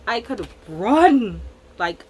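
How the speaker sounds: ordinary talk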